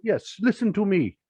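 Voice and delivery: high, slow, hammed-up Nigerian accent; mock Nigerian accent. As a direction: draw out a playful Nigerian accent like a cartoon villain. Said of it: "that was my best Nigerian accent".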